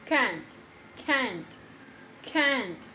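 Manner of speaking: "can't" repeated three times, crisp final T; "can't" said slowly and clearly, T sounded at the end; careful can't. Shown as a demonstration. Teacher drilling the word "can't" for students. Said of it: "Hay que tener mucho cuidado con la pronunciación".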